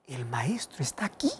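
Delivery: hushed whisper; whispered news. Acted. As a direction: whisper it like a secret. Marta tells María of Jesus. (susurro: el maestro ha llegado)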